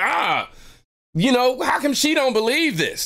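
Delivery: high, exasperated inner outburst; frustrated, high-pitched retold thought; pissed off. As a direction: act out disbelief with the voice shooting up. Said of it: "I'm pissed off at this woman".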